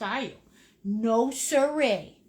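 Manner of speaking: ordinary delivery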